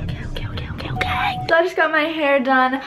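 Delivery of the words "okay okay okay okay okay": rapid whispered okays; whispering. Real, not acted. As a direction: whisper agreement quickly. Whispered quick agreement.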